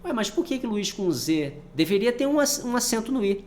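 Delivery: high, surprised student objecting; puzzled student voice. Pretend. Teacher voices a student's doubt in a high puzzled voice. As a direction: ask it like a confused pupil. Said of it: "Não, porque Luiz com Z é espanhol".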